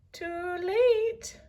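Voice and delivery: high, shrill-free parrot croak; parrot squawk. Acted. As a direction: squawk it like a bird. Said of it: "came a squawk".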